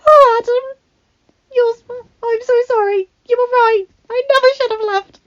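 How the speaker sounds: very high, loud, tearful outpouring; crying apology. A narrator's reading. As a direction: wail the apology, full of remorse. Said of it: "she cried".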